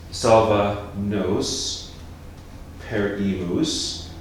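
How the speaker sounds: "Salva nos perimus" spelt out slowly, each syllable apart; slowly counted syllables. Shown as a demonstration. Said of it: "because perimus has three syllables".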